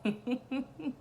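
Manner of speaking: loud, laughing through the words; really amused, breaking into laughs; tickled. Real, not acted. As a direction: burst out loud, barely holding in real laughter. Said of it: "it's still tickling me".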